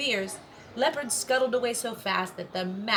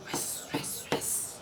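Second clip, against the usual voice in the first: whooshing sand; 8 dB quieter, much slower than usual. A narrator's reading. Slammed into sand.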